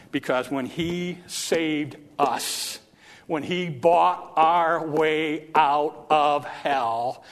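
slow, emphatic preaching. Real, not acted. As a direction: hammer it out word by word. Preacher driving home salvation.

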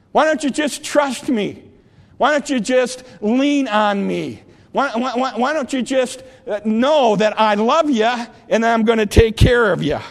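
Jesus pleading for the disciples' trust; impassioned plea. Acted. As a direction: beg them to trust him. Jesus pleading at full preaching force.

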